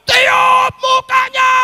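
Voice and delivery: very loud, slow, drawn-out yell of "Tiup mukanya!"; yelled order. Retold or imitated. Preacher yelling the instruction down the phone, slow and very loud. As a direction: bellow it slowly so he finally hears. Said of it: "saya teriak".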